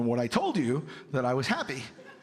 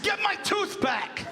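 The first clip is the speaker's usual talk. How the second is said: much higher, slow hysterical cry; hysterical kid demand. Retold or imitated. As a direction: wail the demand like a frantic six-year-old. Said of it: "I said".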